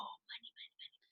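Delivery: hushed, voiceless mock money chant; playful whisper. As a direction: whisper it like a pretend subliminal message. Imitates listeners hearing her money voice.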